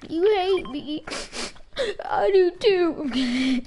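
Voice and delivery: high, slow fake sobbing; hammed-up fake cry; mock crying.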